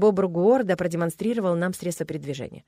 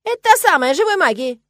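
very loud, high-pitched squeal; excited shouting; 9 semitones higher, 10 dB louder than usual. A narrator's reading. Beaver wife recognises living magic, excited shout. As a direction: shout it out in amazement. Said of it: "Ты чего кричишь?".

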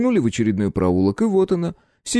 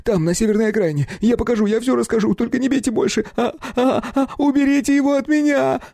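terrified pleading. A narrator's reading. Terrified captive begging.

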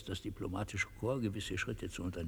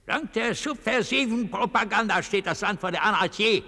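shouted, much higher and louder; sudden outburst; 14 dB louder than usual. Acted. Dictator shouting to the delegation, sudden outburst. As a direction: blast it out as a tirade.

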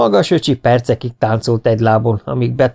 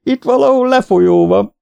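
very high, loud holler; comic panicked yell; 13 semitones higher, 5 dB louder than usual. A narrator's reading. Floundering swimmer warns of a drain, yelling. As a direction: yell it out as if being sucked under. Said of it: "ordította Kásás Zoli".

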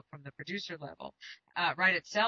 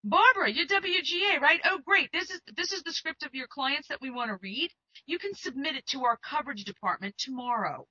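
upbeat studio exec; 6 semitones higher, 4 dB louder than usual. Retold or imitated. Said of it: "this is what I hear".

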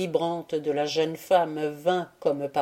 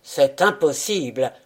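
impetuous; 3 semitones lower, 4 dB louder than usual. A narrator's reading. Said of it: "dit Eilimer avec impétuosité".

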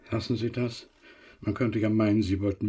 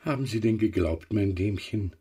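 ordinary talk

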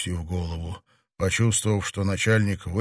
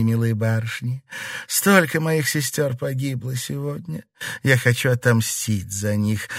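gloating; 2 semitones higher than usual. A narrator's reading. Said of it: "женщина злорадно усмехнулась".

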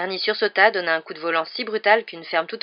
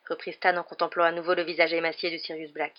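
plain reading voice

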